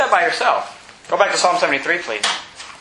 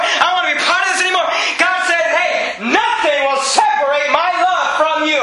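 full-force preaching; 6 semitones higher than usual. Acted. Pastor rallying the discouraged congregation, full-force preaching. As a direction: preach it hard to the back row.

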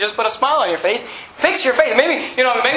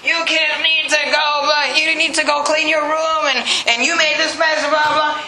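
put-on higher, scolding rant at the kids; parent ranting; 5 semitones higher than usual. Parent scolding kids about their messy room, higher ranting voice. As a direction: play the fed-up parent mid-rant. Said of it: "You're in the middle of some rant".

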